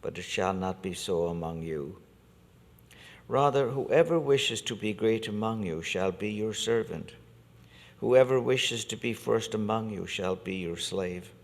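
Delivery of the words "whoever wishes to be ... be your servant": normal talking voice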